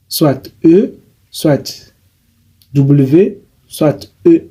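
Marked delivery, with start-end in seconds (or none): none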